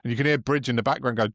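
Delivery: normal talking voice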